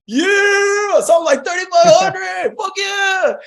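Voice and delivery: high, loud celebratory yell with a laugh; hyped shout. Retold or imitated. Rookie celebrating his commission, shouting high and laughing. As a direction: yell it out like you just won. Retelling his own hype at 3500.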